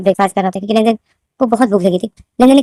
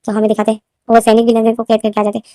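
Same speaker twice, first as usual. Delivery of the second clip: ordinary delivery, nothing marked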